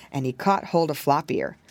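ordinary delivery

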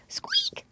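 tiny, extremely high squeak; thin high-pitched mouse cry. A narrator's reading. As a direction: squeak like a startled little mouse. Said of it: "cried the mouse".

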